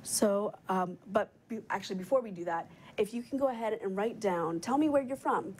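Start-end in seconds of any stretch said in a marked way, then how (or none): none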